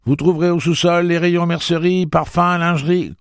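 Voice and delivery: high, loud joking department store call; playful shouted announcement; mock store announcer. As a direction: ham it up like a shop loudspeaker. Eddie jokes like store announcer.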